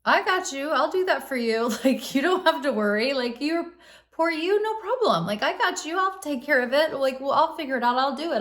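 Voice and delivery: high, bright-eyed eager helper; eager rescuer voice. Retold or imitated. Therapist voicing the rescuer, eager helper. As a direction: sweep in to take it all off their plate. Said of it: "the rescuer is".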